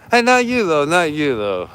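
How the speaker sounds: loud high shout to a passerby; calling out. Real, not acted. Shouting to a stranger across the street, loud and high.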